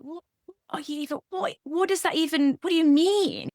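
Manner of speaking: bewildered, can't make sense of the idea; baffled disbelief. Retold or imitated. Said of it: "I was like".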